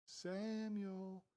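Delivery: hushed, drawn-out call; mysterious calling voice. Acted. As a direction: call the name softly and long. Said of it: "all of a sudden he hears".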